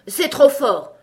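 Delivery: a giant shouting in fury; outraged cry. Acted. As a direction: explode with outrage. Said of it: "s'écria celui-ci".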